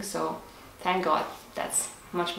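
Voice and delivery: normal talking voice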